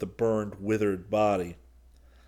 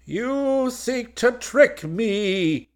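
much higher, slow, hollow throaty accusation; hollow villain voice; 7 dB louder, much slower than usual. A narrator's reading. Golgotha accusing Solo of a trick, hollow and menacing. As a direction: let it rise eerily from the throat. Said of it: "Golgotha muttered hollowly in his throat".

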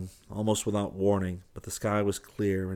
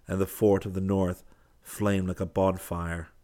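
usual speaking voice

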